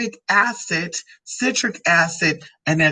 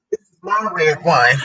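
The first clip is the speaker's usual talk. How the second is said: ordinary delivery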